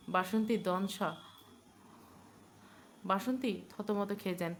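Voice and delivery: ordinary delivery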